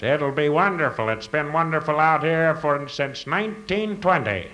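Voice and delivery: mocking a cheery local promising nice weather, pitched high; mock local boast. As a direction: chirp it high like an overeager local booster. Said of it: "down south, same thing".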